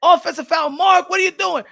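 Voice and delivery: high, loud referee-style call; coach shouting. Retold or imitated. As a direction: yell the foul call at him. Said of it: "coach said".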